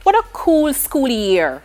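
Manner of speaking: drawn-out, heavy mock enthusiasm; loud sarcastic wow, then slow fake delight. Shown as a demonstration. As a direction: lay the sarcasm on, stretching the words. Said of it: "if you're guessing sarcasm".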